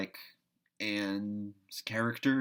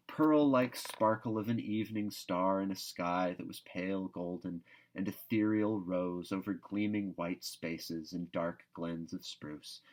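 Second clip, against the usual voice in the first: ordinary delivery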